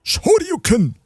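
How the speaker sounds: high, hammed-up anime attack shout; mock battle shout. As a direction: yell it like a fighting-game special move. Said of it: "shout something like".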